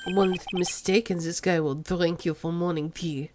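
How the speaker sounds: put-on low, soft French accent, purring; smooth French accent crooning at the rookie; a hint of a lazy French accent. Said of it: "made Armando have a French accent".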